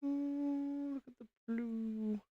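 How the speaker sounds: an awed near-whisper at a pretty piece; hushed ooh. Real, not acted. Streamer admiring a blue build piece, hushed and cooing.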